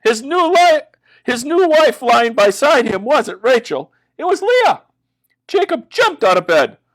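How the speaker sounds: high and loud, aghast; rising alarm, loud and high; shocked narration. A narrator's reading. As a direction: tell it like a gasp of horror. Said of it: "Jacob woke up and screamed".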